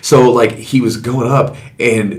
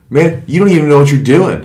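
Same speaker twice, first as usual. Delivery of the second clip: higher, louder mock indignation; retold anger; 6 semitones higher, 5 dB louder than usual. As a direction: imitate a mad teen telling off the ref. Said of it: "He was so mad".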